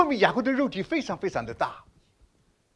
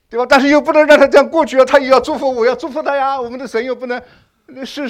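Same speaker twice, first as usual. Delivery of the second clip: louder, driving sermon build; fiery preaching; 4 semitones higher, 8 dB louder than usual. Acted. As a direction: preach it hard to the congregation. Preaching at full force.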